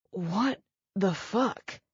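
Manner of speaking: slow, strained repeat, still short of breath; winded again. Acted. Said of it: "he said again".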